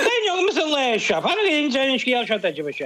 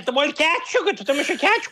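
normal talking voice

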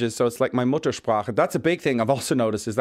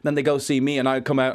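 normal talking voice

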